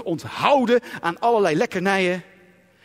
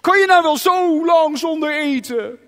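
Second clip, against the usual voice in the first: worried soul; 5 semitones higher, 5 dB louder than usual. Pretend. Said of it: "mijn ziel is heel erg bezorgd".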